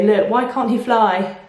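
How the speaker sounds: plain reading voice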